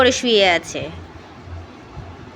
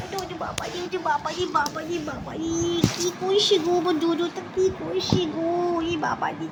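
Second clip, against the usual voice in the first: cooing to the dog; 6 semitones higher, 4 dB quieter than usual. Real, not acted. Owner soothing her lonely dog with soft high coos. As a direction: coo softly up high, petting him with your voice. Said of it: "একটু খুঁচিয়ে খুঁচিয়ে একটুখানি ওকে charm করতে হয়".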